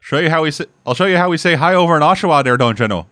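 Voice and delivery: put-on small-town Canadian; Canadian accent. Showing how they talk in Oshawa, mock Canadian accent. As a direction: lay on a folksy Canadian accent. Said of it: "if that's not Canada".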